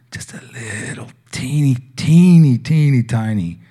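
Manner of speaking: put-on teeny rising high on the repeats, the middle teeny peaking loudest. Stretching how small the love is.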